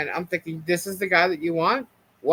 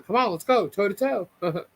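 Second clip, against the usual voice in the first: higher-pitched mock taunt; mock-daring, pitched up; egging him on; 6 semitones higher than usual. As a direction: imitate someone goading a rival into a fight. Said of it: "he's also egging him on".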